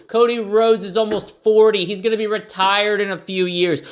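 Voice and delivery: ordinary delivery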